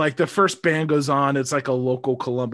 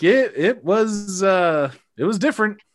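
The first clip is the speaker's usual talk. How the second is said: hesitant polite date; 8 semitones higher, 6 dB louder than usual. Retold or imitated. Date answering about the show, hesitant higher voice. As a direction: search for a kind word. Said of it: "she's like".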